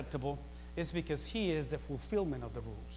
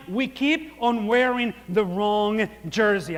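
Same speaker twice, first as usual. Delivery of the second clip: loud, higher pulpit preaching that drives every phrase; preaching at full force; 5 semitones higher, 12 dB louder than usual. Acted. Pastor to church on wrong jersey, preaching at full force. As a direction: preach it hard and build the fire.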